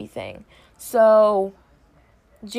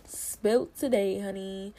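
sassy gossip voice; 8 dB quieter than usual. Retold or imitated. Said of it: "I was like".

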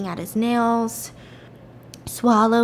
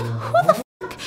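very high squeaked disbelief; acted shocked disbelief; slower than usual. Woman seeing a stranger introduced as Jane, squeaked shock. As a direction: squeak it out, aghast.

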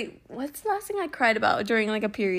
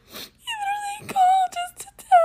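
very high tearful squeal; hammed-up wailing over something sweet; mock crying. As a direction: imitate blubbering through the words. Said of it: "and I started crying".

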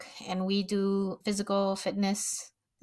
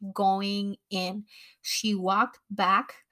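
ordinary delivery

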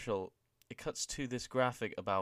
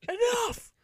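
higher, much louder furious yell; mock GM shout; 8 dB louder than usual. General manager shutting down a brawl from the stage, shouting. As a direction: bark it down from the stage. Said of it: "Daniel Bryan from the stage goes".

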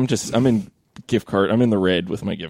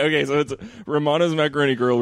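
ordinary talk

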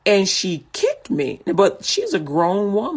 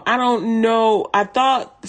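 ordinary talk

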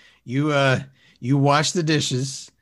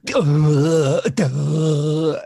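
mocking a slow-witted grunt, louder; dim grunt; 3 semitones higher, 6 dB louder than usual. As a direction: play him as a dumb lunk going duh. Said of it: "and you go".

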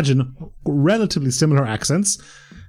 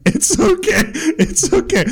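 very high-pitched, laughing mock reassurance; hammed-up reassurance; slower than usual. Tourist soothing the embarrassed shop girl, hammed-up reassurance. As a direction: squeak it out as if soothing someone mortified.

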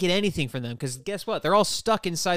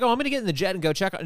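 eager hero; 6 semitones higher, 4 dB louder, much faster than usual. Retold or imitated. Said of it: "He's not like".

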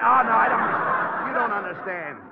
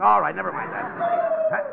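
exasperated; 4 dB louder, slower than usual. Acted. Abbott exasperated by necking joke.

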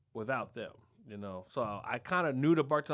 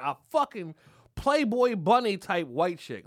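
ordinary talk

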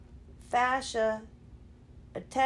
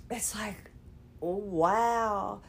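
whisper; 3 semitones lower, much slower than usual. Real, not acted. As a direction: let it drop to a whisper. Sore, trailing off.

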